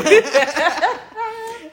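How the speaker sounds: high loud burst of laughter; real laughter; big laugh. Real, not acted. Laughing at own joke.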